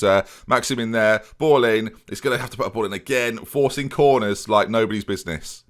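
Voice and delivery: usual speaking voice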